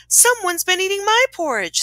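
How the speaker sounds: high-pitched storybook mother bear; Mama Bear. A narrator's reading. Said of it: "said the Mama Bear".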